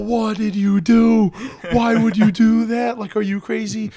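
high-pitched mock panic, words stretched at first; freaking out. Nurses scolding a coworker for drinking a sample, mock freak-out. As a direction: imitate alarmed nurses freaking out. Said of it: "And they're freaking out".

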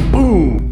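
high, loud boom; sudden boom. Retold or imitated. Imitates sudden bang on bed.

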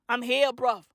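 ordinary talk, nothing marked

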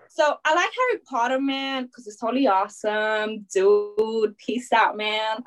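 badly faked, slow American slacker talk; American accent. Shown as a demonstration. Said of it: "you do an American accent".

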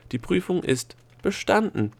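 one voice pronouncing the verdict; solemn solo announcement. A narrator's reading. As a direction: proclaim the result alone, stately. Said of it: "verkündete sie feierlich".

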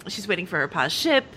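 usual speaking voice

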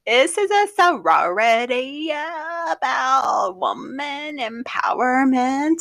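put-on high, slow, drawn-out sorority girl; vocal-fry sorority parody; fake sorority voice. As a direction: stretch every syllable like a mock sorority president. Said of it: "doing the fake sorority voice".

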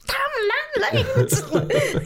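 high, very slow hammed-up curse, laughing; mock curse. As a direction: drag out a fake outrage at the phones, breaking into a laugh. Characters cursing phones, mock outburst.

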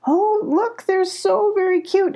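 high-pitched delight at something cute; excited cooing. A narrator's reading. Molly looks closely at the kits, cooing excitedly. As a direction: coo over the babies, pitched high. Molly admires baby beavers.

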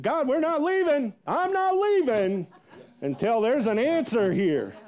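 high, loud, insistent plea to God; fervent demanding prayer. Retold or imitated. Pastor praying and pacing for an answer, fervent demand. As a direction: cry out stubbornly, stretching the words. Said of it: "I was in it".

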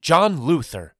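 sharp, high, loud scolding; snapping. A narrator's reading. Wife rebukes husband for wishing to die, snapping. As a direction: snap the name as a rebuke. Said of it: "snapped Mama".

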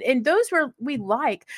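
ordinary talk

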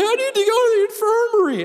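put-on very high, whiny kid on the verge of tears; Dana. Seventh grader begging his counselor, high tearful whine. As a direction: plead in a mock-tearful child's voice. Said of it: "he starts crying".

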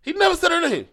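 high, insistent denial; emphatic denial. Real, not acted. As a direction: protest it sharply, voice up high. Heated denial.

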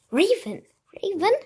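mother calling Raven from afar. A narrator's reading. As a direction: call her name to fetch her. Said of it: "rief Misses Lepus".

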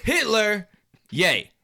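slow, high, drawn-out Kanye voice; [name] impression. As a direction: quote him slowly and high, drawn out. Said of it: "and I quote".

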